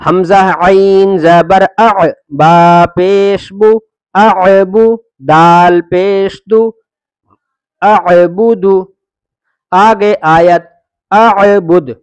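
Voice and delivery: slow, drawn-out letter-by-letter chant; teacher reciting each syllable deliberately; spelling recitation. Acted. Teacher spelling the lesson for children, slow chant.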